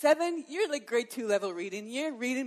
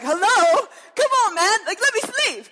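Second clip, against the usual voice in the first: loud, high, exasperated laughing protest; mock annoyed wife; 10 semitones higher, 13 dB louder than usual. A wife to her husband waking her, loud mock exasperation. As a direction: push him off, half laughing.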